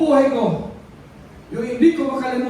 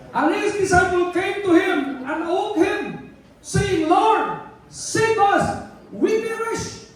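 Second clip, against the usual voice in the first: scripture reading; 5 semitones higher, much slower than usual. Acted. Reads a Bible verse.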